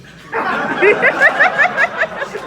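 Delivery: loud high laugh breaking out; burst of laughter. Real, not acted. Narrator laughing hard at the scene. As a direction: narrator cracking up mid-read.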